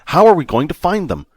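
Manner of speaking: loud, high urgent demand. A narrator's reading. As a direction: demand an answer now. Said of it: "Vic demanded of Argmon".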